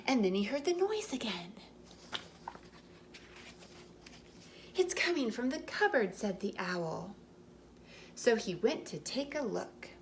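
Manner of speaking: gentle storybook read-aloud; reading to children. A narrator's reading. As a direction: tell it like a bedtime story for little ones. Reading picture book aloud.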